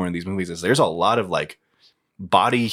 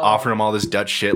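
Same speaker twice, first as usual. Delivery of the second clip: ordinary talk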